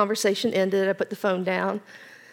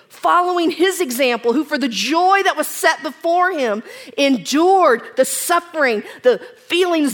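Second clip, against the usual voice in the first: loud, higher, building sermon voice; full preaching; 5 semitones higher, 5 dB louder than usual. Acted. Preacher lifting the congregation about Christ's endurance, full force. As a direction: drive each phrase home from the pulpit.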